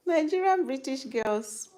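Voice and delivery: usual speaking voice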